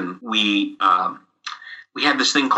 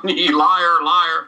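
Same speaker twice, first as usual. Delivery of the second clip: taunting; 5 semitones higher, 3 dB louder than usual. Retold or imitated. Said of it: "everyone beating him up for being".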